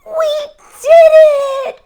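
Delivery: very high loud laughing cheer; joyful cry. Acted. Toad celebrating the flying kite, high laughing cheer. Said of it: "cried Toad".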